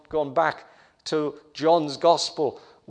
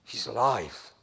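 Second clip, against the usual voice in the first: soft, low, reverent statement; hushed awe; 8 semitones lower, 8 dB quieter than usual. Real, not acted. Preacher to congregation on the risen Christ, hushed awe. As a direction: let it fall quietly, full of wonder.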